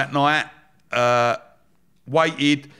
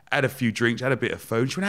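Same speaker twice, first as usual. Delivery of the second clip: ordinary talk, nothing marked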